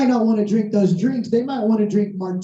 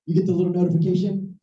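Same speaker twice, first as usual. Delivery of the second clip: ordinary talk, nothing marked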